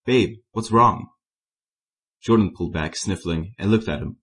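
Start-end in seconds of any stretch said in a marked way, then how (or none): 0.1-1.1 slow, gentle and caring; soft concern. A narrator's reading. Alex comforting his upset friend, slow and soft. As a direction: ease him with slow tender words. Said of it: "He spoke softly".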